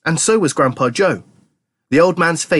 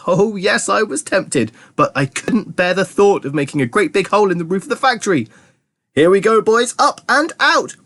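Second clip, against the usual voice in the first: high, eager exclaiming; giddy, raised-pitch confession; excited; 7 semitones higher than usual. A narrator's reading. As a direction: build to a jubilant high call. Wonka announces the launch excitedly.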